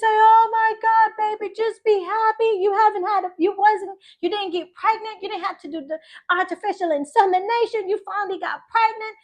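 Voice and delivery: high-pitched exasperated mom; mother's high, urgent pleading, retold; imitating mom. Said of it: "I call my mom".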